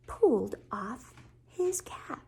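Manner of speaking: whispered storybook reveal; hushed suspense. A narrator's reading. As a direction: whisper the surprise to young listeners.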